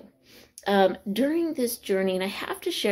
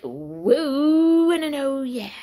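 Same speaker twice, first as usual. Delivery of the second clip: slow, drawn-out cheer, louder and higher; ten finger woo cheer; 5 semitones higher, 6 dB louder, much slower than usual. Shown as a demonstration. Teacher demonstrating the school cheer for her students, slow and loud. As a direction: show the class how the cheer goes. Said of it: "it goes a little something like this".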